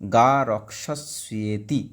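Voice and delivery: গা রক্ষস্যেতি said slowly, in parts; slow broken reading. Shown as a demonstration. Teacher reading গা রক্ষস্যেতি slowly for the class. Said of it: "ভেঙে দিয়েছি ব্র্যাকেটের মধ্যে".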